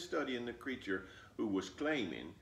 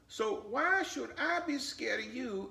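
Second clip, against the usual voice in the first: put-on much higher, curious child voice; little girl asking; 14 semitones higher, 4 dB louder than usual. Flossie asking her grandmother about the fox, high and curious. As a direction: ask like a puzzled little girl.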